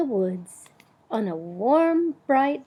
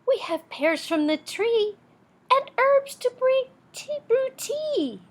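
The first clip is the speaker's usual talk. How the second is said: much higher, lilting bird chorus; singsong bird voice; 13 semitones higher than usual. Acted. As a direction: chirp it like little birds. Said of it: "in flutters Owl with Raven and Wren".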